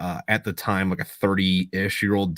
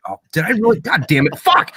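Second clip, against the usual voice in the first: higher, louder mock outburst at himself; imitating buddy's dismay; 9 semitones higher, 6 dB louder than usual. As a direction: play him swearing at himself, raised and loud. Buddy realizes he choked girlfriend.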